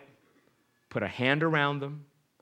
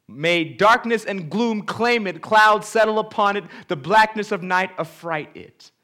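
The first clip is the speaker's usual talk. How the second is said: loud, high declaiming read; scripture reading; 5 semitones higher, 10 dB louder than usual. Acted. Preacher reads Job's curse to the congregation, loud declamation. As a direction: curse the day as Job would.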